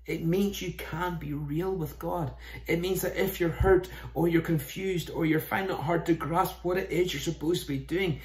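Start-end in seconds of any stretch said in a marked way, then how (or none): none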